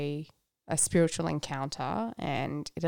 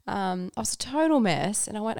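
ordinary talk

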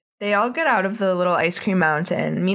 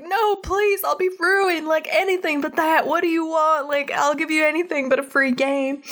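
mock begging; 9 semitones higher than usual. Earl begs the dads.

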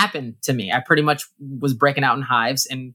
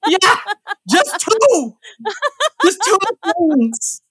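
squeaky laughing, much higher; much slower than usual. Real, not acted. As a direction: laugh the words out, slowly. Laughing at own story.

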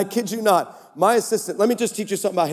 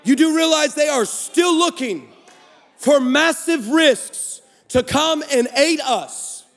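full-force preaching; 5 semitones higher, slower than usual. Acted. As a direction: preach it, rising with each line.

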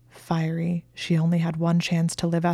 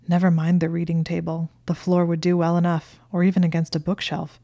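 ordinary delivery, nothing marked

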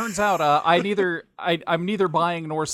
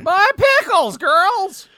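much higher, loud cartoon exclamation; Powerpuff cartoon voice; 8 dB louder, slower than usual. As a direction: squeal it like a cartoon character. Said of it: "Some Powerpuff Girls references".

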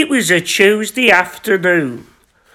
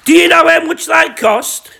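indignant; 6 semitones higher than usual. Acted. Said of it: "Gary whispered".

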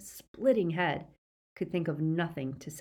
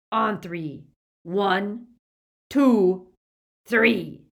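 counting louder and higher; countdown; 4 semitones higher, 8 dB louder, much slower than usual. A narrator's reading. Greyback counts down to apparate, building loud count. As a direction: build to three.

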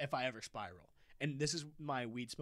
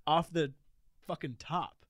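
triumphant reveal; 4 semitones higher, 7 dB louder, slower than usual. Real, not acted. Revealing the big number.

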